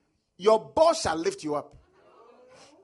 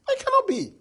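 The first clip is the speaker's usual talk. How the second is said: incredulous; faster than usual. Acted. Preacher marvels at Joseph's rise.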